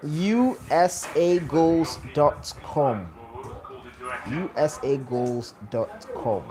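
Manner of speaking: spelled out slowly, letter by letter; slow dictation. Shown as a demonstration. Streamer to chat, slowly dictating a website. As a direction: read the web address slowly so viewers can copy it. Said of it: "I think it's quite straightforward".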